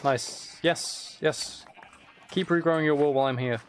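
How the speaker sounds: ordinary delivery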